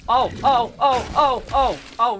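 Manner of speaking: high, loud panicked oh-oh-oh. Real, not acted. Gamer under attack, high panicked oh-oh. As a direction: blurt in a rush of panic. Panicking during a game fight.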